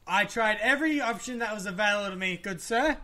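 loud, high indignant protest. Real, not acted. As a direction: huff it out like a wronged gentleman. Mock-formal indignant self-defense.

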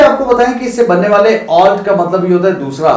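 usual speaking voice

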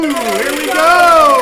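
slow, loud, high drawn-out cheer; game-show suspense call; excited build-up. Real, not acted. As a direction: stretch it out while the wheel spins. Wheel spinning.

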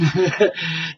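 breathy little laugh; short chuckle. Real, not acted. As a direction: chuckle at yourself. Laughing about boogieing.

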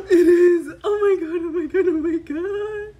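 loud, high exclamations, one after another; thrilled. Real, not acted. Fan seeing the group photo card, thrilled loud cry. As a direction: burst out in joyful disbelief.

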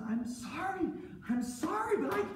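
small, very high pleading voice; timid apology. Acted. Slave boy refuses his master's wife, timidly apologetic. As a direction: apologize high-pitched, without pushing.

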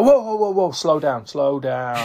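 mock alarm, settling as it goes; calling a halt. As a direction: imitate a coach reining runners in. Acting out coach halting the runners.

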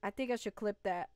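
normal talking voice

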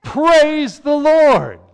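high, loud, slow burst of praise; joyful praise. Shown as a demonstration. Pastor showing praise even when feeling down. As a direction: lift it up bright and loud. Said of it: "that we should go".

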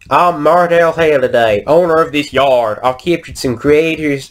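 attempted American accent for a game character. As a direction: read it out in a put-on American voice. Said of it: "American accent".

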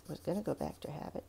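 ordinary talk, nothing marked

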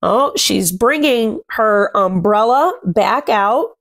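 usual speaking voice

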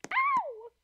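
sharp high cry of pain; pained yelp. Acted. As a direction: yelp as the rock hits. Hit with a rock.

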